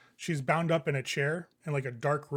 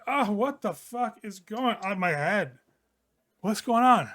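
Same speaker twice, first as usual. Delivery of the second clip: groggy hungover; 5 semitones higher, 4 dB louder, slower than usual. Acted. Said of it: "He's like".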